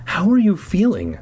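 usual speaking voice

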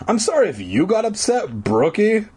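high, mock-sorry taunt; boastful teasing. A narrator's reading. Zeke boasts to his upset friend, teasing. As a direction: tease with a fake apology. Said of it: "Zeke boasted".

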